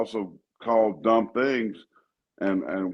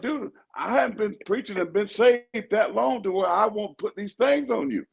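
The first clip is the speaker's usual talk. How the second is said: mock threat to neighbor; 12 semitones higher than usual. Retold threat to old man.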